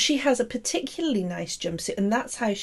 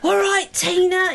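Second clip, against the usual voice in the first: higher put-on Cockney greeting; Ben's mock Cockney; 7 semitones higher than usual. Co-host's supposed message to her, mock Cockney accent. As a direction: hail her in cartoon Cockney. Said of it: "I'm sure Ben talks like that".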